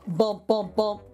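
ordinary delivery

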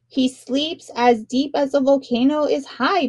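plain reading voice

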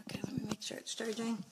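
soft whisper to herself. Real, not acted. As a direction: whisper under the breath while busy. Muttering while fixing her phone.

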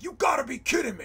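loud, high, disbelieving yell; retelling a freak-out in disbelief. Retold or imitated. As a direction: blurt it out in shock. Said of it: "I freaked out".

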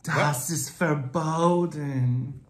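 very slow mock-German pronouncement; hammed-up German rule enforcer; turned-on German enforcer. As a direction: draw out the ban with relish. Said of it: "You're turned on by enforcing rules".

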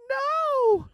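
crowd shout. Retold or imitated. Fans refusing the new album, loud mock shout. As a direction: yell it back at the band. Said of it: "We're like".

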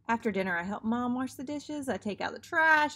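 plain reading voice

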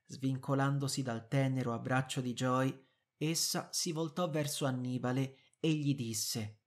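plain reading voice

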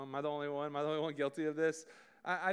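ordinary talk

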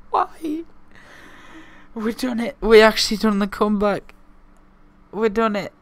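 high, loud elated shouting; excited disbelief. Real, not acted. Gamer celebrating his comeback, high elated. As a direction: shout in delight.

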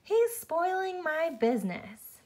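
high, stretched-out shout at no great volume; narrated shout. A narrator's reading. Arthur ordering the soap-eating dog out, storybook shout. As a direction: draw out each word in high-pitched outrage. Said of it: "shouted Arthur".

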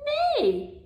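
whinny the word Neigh like a horse. Shown as a demonstration. Speech therapist showing a toddler the horse sound, high whinnying Neigh. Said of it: "The horse says neigh".

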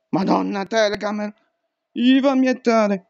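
ordinary delivery

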